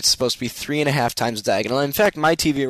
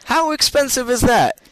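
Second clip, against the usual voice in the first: outraged disbelief; 8 semitones higher, 3 dB louder than usual. Real, not acted. Repeating price in outrage.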